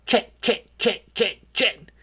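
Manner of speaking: high chattering chits; squirrel chatter. A narrator's reading. Squirrels chattering in the birch tree, high chatter.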